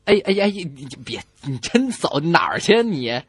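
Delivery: usual speaking voice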